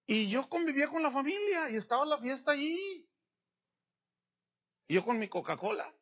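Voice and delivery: normal talking voice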